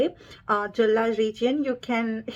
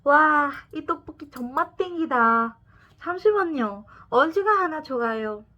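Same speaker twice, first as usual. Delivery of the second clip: Seoul slang demo; 5 semitones higher, much faster than usual. Shown as a demonstration. Seoul Gen Z slang example.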